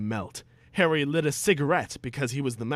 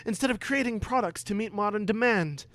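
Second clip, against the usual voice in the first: higher, keen questioning voice; eager schoolboy Harry; 8 semitones higher, 4 dB louder than usual. Acted. Harry questioning Snape in class, higher eager boy. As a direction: pipe up like a cheeky pupil. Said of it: "Snape asked".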